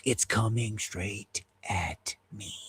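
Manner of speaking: whispered alarm. Real, not acted. Plane coming at him again.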